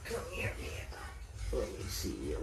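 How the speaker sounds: slow, soft whispered coaxing. Real, not acted. Owner coaxes shy cat nearer, slow whisper. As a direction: whisper to lure the cat close.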